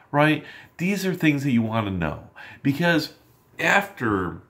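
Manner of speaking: normal talking voice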